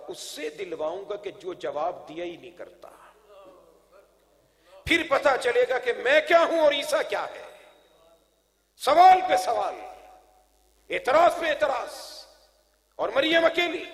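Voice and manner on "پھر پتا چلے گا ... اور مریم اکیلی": ringing sermon proclamation; preaching at full force. Real, not acted. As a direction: proclaim each line to a big congregation. Preaches to a crowd.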